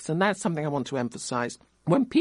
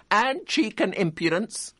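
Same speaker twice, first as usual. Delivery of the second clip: jeering collier; 7 semitones higher than usual. A narrator's reading. Another collier jeers.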